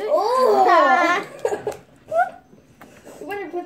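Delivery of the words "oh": loud burst of laughter; laughing. Real, not acted. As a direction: crack up suddenly. Friend choking on coffee.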